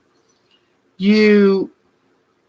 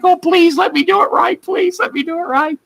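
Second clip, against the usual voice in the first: pleading; 10 semitones higher than usual. Retold or imitated. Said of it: "I was like".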